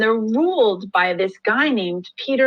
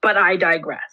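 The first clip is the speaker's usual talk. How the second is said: ordinary talk, nothing marked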